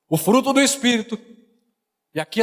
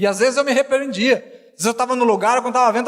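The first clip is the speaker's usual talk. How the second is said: ordinary talk, nothing marked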